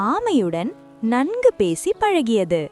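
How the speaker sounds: ordinary delivery, nothing marked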